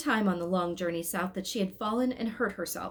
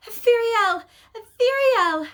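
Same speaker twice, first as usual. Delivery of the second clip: much higher, loud calling to the angel; crying out; 11 dB louder, much slower than usual. A narrator's reading. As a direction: call out to the angel above the market. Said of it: "stretched out her arms toward the angel and cried".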